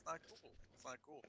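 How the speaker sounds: barely audible mutter; muttered under breath. Real, not acted.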